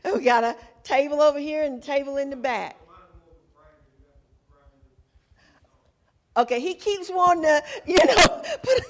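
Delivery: high, fast, laughing through the words. Real, not acted. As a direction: tease them while cracking up. Teasing the salt-loving audience member.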